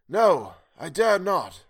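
refusing while starving; stifled craving. Acted. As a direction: hold back a desperate hunger. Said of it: "a note of stifled craving".

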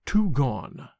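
understated ominous drop in the voice; voice lowering strangely. A narrator's reading. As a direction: let the words sink, measured and eerie. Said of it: "his voice lowering strangely".

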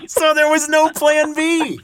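high, slow squeal through laughter; real laughing. Real, not acted. Host teasing guest about having no backup, laughing. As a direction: crack up while drawing the words out.

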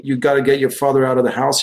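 ordinary delivery

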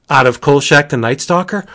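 ordinary delivery

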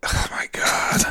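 breathy awed whisper. Real, not acted. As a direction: whisper it in disbelief. Awed reaction to year three.

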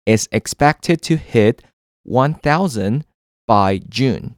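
slow, deliberate example sentence. Shown as a demonstration. Teacher reading an example slowly, stretching is expected to. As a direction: spell out the phrase slowly for learners. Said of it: "所以我就可以说".

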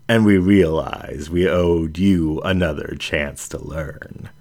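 plain reading voice

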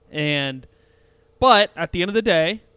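ordinary delivery

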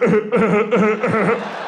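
repeated stifled chuckles, acted; friend's mock snickering. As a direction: snicker in bursts as the prankster friend. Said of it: "George was like".

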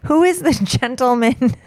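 a hint of a laughing dad. Imitating dad asking Alexa.

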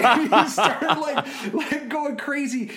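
words high and bubbling with laughter; laughing while telling. Real, not acted. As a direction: laugh through the story. Laughing while retelling son's antics.

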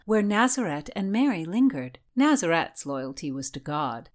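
usual speaking voice

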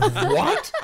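a disbelieving laughing yelp; incredulous what. Retold or imitated. Baffled at wife's complaint.

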